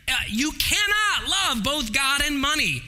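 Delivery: "cannot" hit hard and high, shouted; preacher hammering "cannot"; stress on cannot. Acted. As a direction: punch the word "cannot" with full force. Preacher driving home a point.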